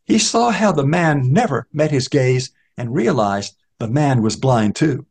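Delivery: ordinary delivery, nothing marked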